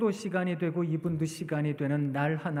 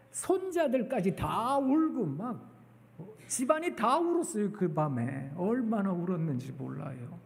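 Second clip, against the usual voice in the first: usual speaking voice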